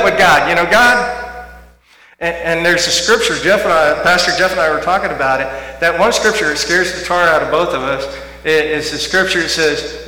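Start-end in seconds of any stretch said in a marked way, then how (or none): none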